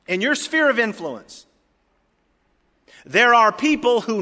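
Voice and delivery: high, loud, emphatic preaching. Real, not acted. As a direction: preach it out to the whole congregation.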